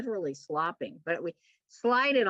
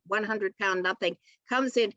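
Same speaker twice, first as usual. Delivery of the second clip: ordinary talk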